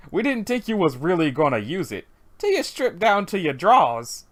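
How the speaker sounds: lightly attempted deep Southern accent. Teasing a partner who took a joke seriously, Southern accent. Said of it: "supposed to be having really deep southern accents".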